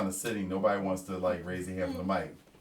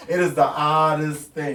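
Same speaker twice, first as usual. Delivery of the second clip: laughing through the words; amused, laughing while telling; 7 semitones higher, 14 dB louder than usual. Real, not acted. Laughing at odd cosplay town.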